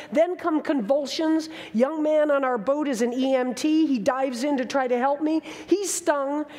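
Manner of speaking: normal talking voice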